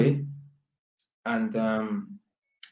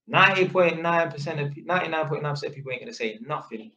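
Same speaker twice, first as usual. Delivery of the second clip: ordinary talk, nothing marked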